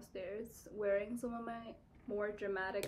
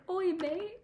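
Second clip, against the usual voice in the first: higher, louder British mate greeting; mock British accent; 10 semitones higher, 5 dB louder than usual. Joking about the British student's accent, mock British. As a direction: put on a cheeky British accent. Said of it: "There's a British person".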